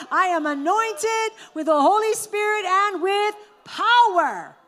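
"I am anointed with the Holy Spirit and with power" called out high, loud and slow; proclaimed with full conviction. Shown as a demonstration. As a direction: declare it slowly, high and loud, with full faith. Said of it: "One more time".

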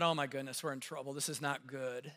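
low, hushed inner panic; quietly realizing we're in trouble; murmured dread to himself; scared thought. Retold or imitated.

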